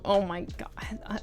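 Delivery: embarrassed whisper. Real, not acted. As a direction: hush it out, flustered. Embarrassed about Swedish question.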